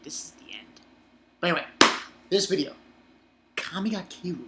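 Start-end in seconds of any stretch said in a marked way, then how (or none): 0.0-0.8 hushed, ominous title drop; spooky whisper. Pretend. Reviewer teasing the movie he will see, whispered.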